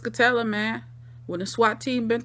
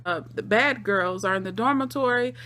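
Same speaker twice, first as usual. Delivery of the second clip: ordinary talk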